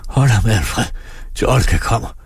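strained, broken pleading from the injured farmer; stammered plea. A narrator's reading. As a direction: force the words out in bursts. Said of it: "da de fik fremstammet". (fremstammet, anstrengt bøn)

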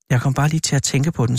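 plain reading voice

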